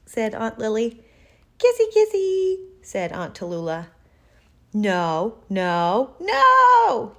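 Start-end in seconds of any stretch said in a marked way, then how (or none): 1.6-2.6 very high, loud kissy-kissy coo; gushing aunt. A narrator's reading. Aunt demanding kisses in story. 4.7-7.2 each refusal louder, the last one yelled; building yell. A narrator's reading. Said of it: "yelled Bernard".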